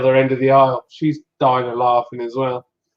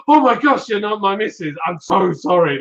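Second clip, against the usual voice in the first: high-pitched, flustered retold apology; 6 semitones higher than usual. Husband apologising to a stranger he took for his missus, flustered. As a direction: imitate yourself apologising in a panic, pitched up. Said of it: "like that".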